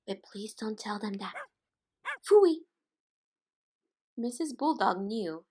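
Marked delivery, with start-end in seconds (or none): 2.2-2.7 high, loud scornful phooey; disgusted phooey. Acted. Puppy voiced in a picture book, loud scornful high phooey. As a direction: spit out the word in disgust.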